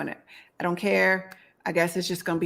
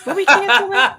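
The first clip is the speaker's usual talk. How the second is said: laughing; 6 semitones higher, 8 dB louder than usual. Real, not acted. Laughing surprised reply.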